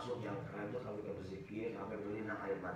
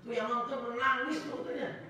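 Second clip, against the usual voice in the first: much higher, louder falsetto crying; mock weeping viewer; 11 semitones higher, 4 dB louder than usual. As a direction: ham up a sobbing moviegoer. Mimics weeping film viewer. (meniru penonton menangis dengan suara tinggi)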